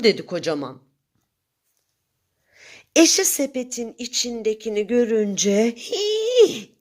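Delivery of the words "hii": high gasping hii of shock; shriek of fright. A narrator's reading. Wife sees the moon in the basket, shrieking hii. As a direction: squeal it in sudden fright. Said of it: "diye bağırdı".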